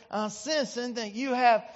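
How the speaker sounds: put-on North Carolina TV healer accent; stretched North Carolina preacher drawl, building on the last words; Ernest Angley's accent. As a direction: imitate a Southern faith healer, halting and drawn out. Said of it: "like, in his great accent".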